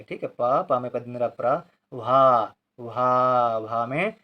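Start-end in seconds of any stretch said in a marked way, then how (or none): 1.9-3.6 भा with a long drawn-out aa; long vowel. Shown as a demonstration. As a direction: hold the vowel of भा. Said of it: "भा देर तक बोला जा रहा है".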